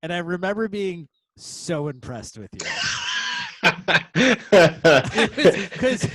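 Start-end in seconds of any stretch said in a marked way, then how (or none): 2.6-6.1 loud high burst of laughter; laughing hard. Real, not acted. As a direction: crack up loudly.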